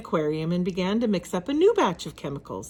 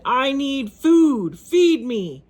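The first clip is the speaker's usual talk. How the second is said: slow, loud and higher monster bellow; monster shout; 5 semitones higher, 7 dB louder, slower than usual. A narrator's reading. Giant pig bellowing at his inventor for food, monster shout. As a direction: roar for food like a starving beast. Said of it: "the giant pig shouted".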